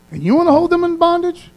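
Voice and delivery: high, indignant challenge; incredulous rebuke. Acted. God to someone holding others in bondage, incredulous. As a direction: scold them in high-pitched disbelief.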